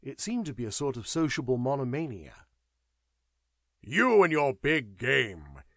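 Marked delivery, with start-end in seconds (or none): 3.8-5.6 louder, slow, jabbing retort; aggressive outburst. A narrator's reading. Sir Howard jeering at Burke, aggressively loud. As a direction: needle him hard and loud, dragging it out. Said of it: "he ejaculated aggressively to Burke".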